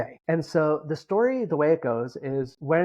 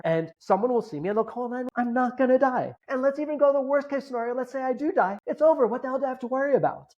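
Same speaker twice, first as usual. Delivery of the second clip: reasoning with himself; 9 semitones higher than usual. Retold or imitated. Talking himself down from panic.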